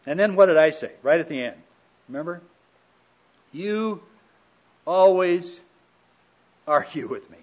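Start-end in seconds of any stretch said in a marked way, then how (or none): 3.5-7.4 louder, higher accusing jab on always; accusatory. Retold or imitated. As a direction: throw the accusation at your spouse. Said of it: "being accusatory in that way".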